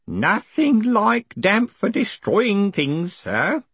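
put-on high, slow knowing old voice; sage old man. A narrator's reading. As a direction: wise nod in the voice, slowly. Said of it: "nodding sagely".

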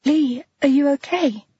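worried Claire. A narrator's reading. Claire worried about Lee.

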